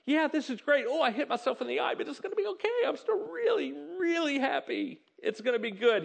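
high-pitched, giddy happy-dance chatter; all happy. Acted. Next employee awaiting his turn with the boss, all happy. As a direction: play someone too happy to mind a knock. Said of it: "He's, like, all happy".

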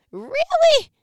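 high, loud mock disbelief; incredulous really. Talking back to God in mock incredulous surprise.

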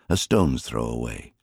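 ordinary delivery, nothing marked

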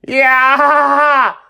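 very high, loud cheer; excited cheer. Acted. Zooming through sky, cheering.

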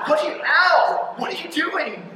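high, loud pretend cry of pain; mock yelp. Teen struck by rocks from his parents, mock loud yelp. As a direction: yelp as if a rock just hit you.

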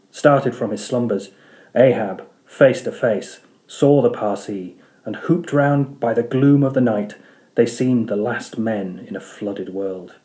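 plain reading voice

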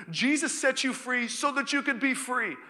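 high, loud scripture proclamation; preaching. Acted. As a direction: proclaim the verse with force. Proclaims scripture at full force.

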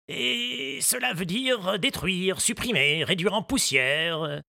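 slow, deliberate explaining voice; gardener explaining. A narrator's reading. Moustache defines the word, slowly. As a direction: spell the word out for a child.

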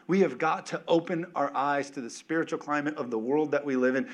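ordinary talk, nothing marked

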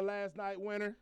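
ordinary delivery

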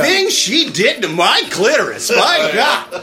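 slow, hammed-up ecstatic confession; mock erotic-letter reader. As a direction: play mock rapture, drawing out the words.

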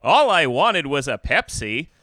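put-on folksy old sitcom dad, slow and loud; fifties dad voice. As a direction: plead like a wholesome fifties dad. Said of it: "fifties dad voice".

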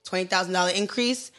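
ordinary delivery

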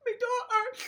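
milk it like a TV sob story; mock sob story. Nominee pulling a sob story for the panel, mock fake crying. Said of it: "the sob story to try corroborate".

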